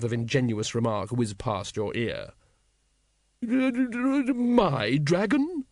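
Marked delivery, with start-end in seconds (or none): 3.4-5.7 put-on high, very slow quavering old wizard; a startled old man's squeak. Old wizard startled by the patrician, high and slow.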